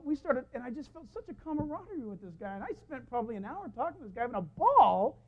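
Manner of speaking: ordinary delivery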